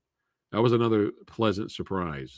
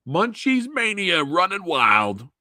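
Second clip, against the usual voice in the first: high, slow, drawn-out hype cry; mock hype shout; 9 semitones higher, 3 dB louder, slower than usual. Rallying viewers for Munchies on four K, mock-excited drawn-out shout. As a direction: holler it like a campaign catchphrase. Hyping a mock fan campaign.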